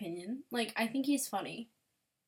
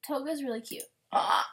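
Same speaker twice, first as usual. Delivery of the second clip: usual speaking voice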